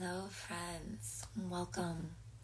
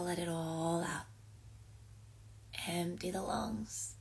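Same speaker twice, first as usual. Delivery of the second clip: guided breathing. Acted. Leads slow breathing exercise.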